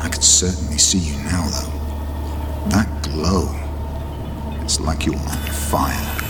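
menacing whisper. Acted. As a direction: hiss it close and low. Villain whispers in crypt.

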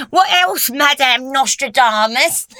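loud, high, drawn-out taunt; teasing. A narrator's reading. Crowd plays its favourite Lucy game, loud teasing. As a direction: tease her with mock grandeur. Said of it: "the crowd teased".

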